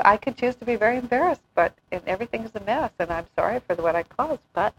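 usual speaking voice

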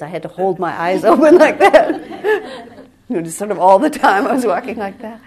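loud, laughing through the words; laughing at herself. Real, not acted. Telling listeners how she held back tears, laughing loudly. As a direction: chuckle as you tell the silly memory. Laughing about holding eyes open.

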